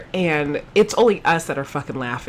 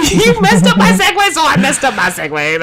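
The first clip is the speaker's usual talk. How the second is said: laughing; 7 semitones higher, 3 dB louder than usual. Real, not acted. Laughing at messed segue.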